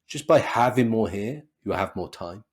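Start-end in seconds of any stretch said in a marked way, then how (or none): none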